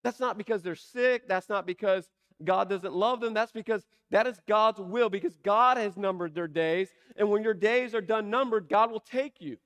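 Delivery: loud, driving pulpit delivery; preaching at full force. Acted. Preacher building to the congregation, loud and driving. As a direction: hammer each line home to the congregation. Preacher drives home the point.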